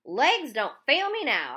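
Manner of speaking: loud, slow, drawn-out yell. A narrator's reading. Runner yelling at his own legs, loud and slow. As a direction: yell it mid-sprint, pleading with your legs. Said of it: "I yelled as I hit full speed".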